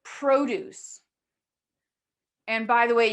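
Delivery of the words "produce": "produce" as "PRO-duce", first vowel full; noun stress pattern. Shown as a demonstration. Teacher repeating the contrast for students, "produce" as noun, first syllable stressed. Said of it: "them as nouns".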